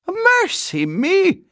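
very high, loud, drawn-out cry; shocked exclamation. A narrator's reading. Housekeeper learning of her new guest, shocked exclamation. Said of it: "exclaimed Missus Bird".

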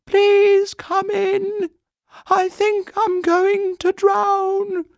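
very high, slow, loud plea; desperate shout. A narrator's reading. Paddington afraid of drowning, shouting to the children. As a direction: wail loudly to be rescued. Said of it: "shouted Paddington".

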